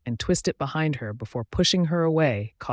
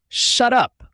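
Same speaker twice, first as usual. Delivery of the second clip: loud, raised-pitch angry command; angry growl; 10 semitones higher, 8 dB louder than usual. A narrator's reading. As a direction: shout them down angrily. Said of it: "I growl, and she obeys".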